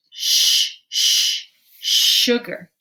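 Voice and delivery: "sh" hissed long and loud, then "sugar"; sounding out. Shown as a demonstration. Teacher labeling the next page, "sh" isolated before "sugar". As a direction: repeat a strong "sh" before saying "sugar". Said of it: "I hear sh at the beginning".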